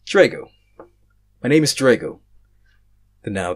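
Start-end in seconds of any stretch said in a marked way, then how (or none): 0.1-2.2 loud, higher declaration; blurted out. Acted. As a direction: blurt it out boldly. Blurts his name, deciding.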